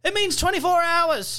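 high, put-on go-between voice; high-pitched parroted announcement; mock relay shout. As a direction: call it up the line in a high voice. Relay bit, go-between repeats it louder.

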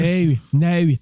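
playful put-on British vowels; British accent. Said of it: "cet accent british en plus".

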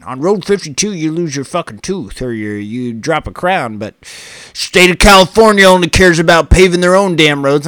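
loud, mocking griping local voice; mock complainer. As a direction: ham up the whiny neighbor, complaining loudly. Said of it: "I've heard this conversation".

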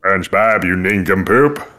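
lightly voiced cartoon villain insult; rough attempt at a cartoon villain; Plankton. Shown as a demonstration. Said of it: "the Plankton voice".

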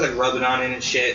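usual speaking voice

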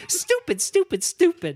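high squeaky stupid stupid; self-scolding. Retold or imitated. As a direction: scold yourself in a shrill high voice. Character scolding herself.

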